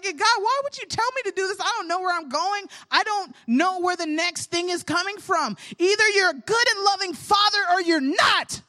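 retold angry prayer. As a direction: throw the hurt back at God, half-shouting. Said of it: "really angry at that moment".

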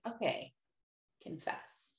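whispered to herself. Retold or imitated. Alone at the start, whispering unsure. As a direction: breathe it out unsure. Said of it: "I'm like".

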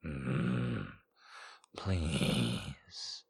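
low, soft seductive purr; seductive demon purr. Acted. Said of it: "he purred".

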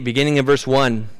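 ordinary delivery

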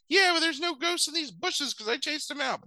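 put-on high-pitched little kid voice; B-Dog. Puppy boasting to the other dogs, high kid voice. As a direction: put on a tiny puppy's bragging squeak. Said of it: "he's got a little kid's voice".

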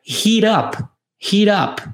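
"heat up" run together as "heerup" with a flapped t; fast linked heat up. Shown as a demonstration. Teacher showing students the fast "heat up", flapped t. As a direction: say "heat up" quickly so it sounds like "heerup". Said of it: "Quando você fala isso um pouco mais rápido".

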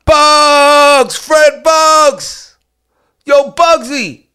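loud, high calling out a name; joyful loud shout-out. Real, not acted. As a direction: hail him like across a room. Shout-out to old friend.

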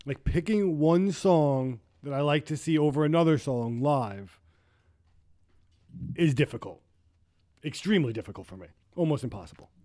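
normal talking voice

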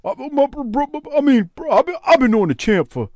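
high put-on voice, stuck on words; stammering guest impression. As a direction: mimic him stuttering through a brag. Mimics guest bragging about the champ.

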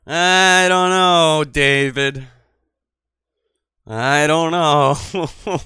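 very slow, loud stretched words; drawn-out mock. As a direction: drag every word out.

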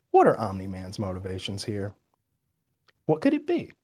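soft, musing to oneself; hushed self-questioning; retold pondering. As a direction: think it aloud quietly, as if puzzling it out. Retelling own private pondering.

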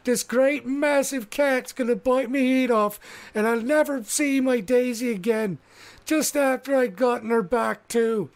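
high, hammed-up blubbering wail, slowing at the start; hammed-up weeping. Mickey pinned under the cat, wailing in mock despair. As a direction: wail like he's about to be eaten. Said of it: "weeping dramatically and openly".

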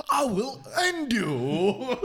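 high, slow, dramatic villain threat; menacing cartoon villain delivery; Disney villain voice. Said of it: "This dude sounds like a Disney villain".

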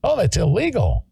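a hint of mocking prissiness; mock objection. Mocking critics' objection again.

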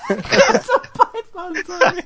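high, slow, laughing meathead voice; hammed-up macho brag. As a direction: ham up the muscle brag.

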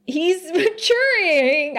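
high, drawn-out strained admission; reluctant admission. Real, not acted. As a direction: squeeze it out slowly, high, with a laugh. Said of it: "I'm afraid to say it out loud".

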